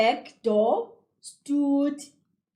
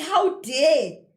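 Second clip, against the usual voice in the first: louder, higher indignant exclamation; angry scolding; 4 semitones higher, 5 dB louder than usual. A narrator's reading. Showing how an angered person talks, indignant. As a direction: throw it out in outrage. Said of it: "Usuke ecasukile lo muntu".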